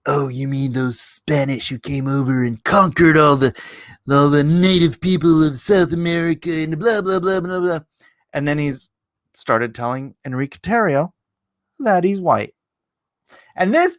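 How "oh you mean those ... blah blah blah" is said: lightly put-on preachy kid voice; a hint of a smug lecturing student; mocking student. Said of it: "the kid's like".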